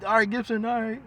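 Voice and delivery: mock plea for mercy; retold begging. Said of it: "got you on the ground begging".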